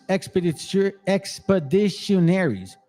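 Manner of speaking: normal talking voice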